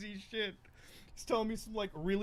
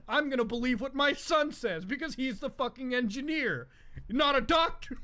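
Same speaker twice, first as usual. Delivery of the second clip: loud, higher scolding rant; imitating lecturing dad; 6 semitones higher, 5 dB louder than usual. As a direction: lecture him sharply like an outraged father. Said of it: "it's like".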